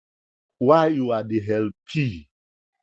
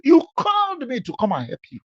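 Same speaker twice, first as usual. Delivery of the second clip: ordinary talk, nothing marked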